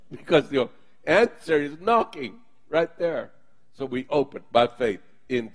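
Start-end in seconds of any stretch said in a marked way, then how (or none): none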